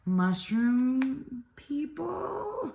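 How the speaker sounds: high, slow squeal of delight on "mushroom people"; delighted gush. Real, not acted. Showing her favorite cards to viewers, delighted gush. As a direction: stretch it out, thrilled.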